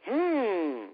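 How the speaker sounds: mock suspicion. Real, not acted. Podcaster doubting a wrestler's injury story to co-hosts, mock suspicion. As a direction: feign amazement at a handy coincidence. Mocks suspiciously well-timed injury, co-host laughs.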